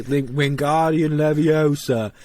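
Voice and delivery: drawn-out spell read with an R after "Leviosa"; actor's version. Retold or imitated. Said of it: "would just say".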